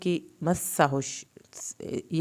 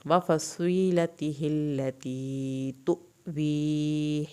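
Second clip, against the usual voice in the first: slow recitation; much slower than usual. Shown as a demonstration. Teacher recites verses to students, slow chant. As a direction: recite slowly, stretching the vowels. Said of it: "صاد کو پر کریں گے".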